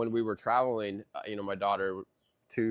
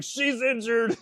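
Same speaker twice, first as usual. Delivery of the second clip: high-pitched mock panic; retold panicked cry; 10 semitones higher, slower than usual. Dad calling to wife about hurt daughter, mock panic. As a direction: pitch it way up, like calling out bad news.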